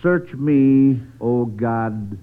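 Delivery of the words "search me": ordinary talk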